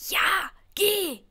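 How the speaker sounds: high, loud eager urging; imagined Harry. Retold or imitated. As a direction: push her to go, all enthusiasm. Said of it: "Harry hätte gesagt".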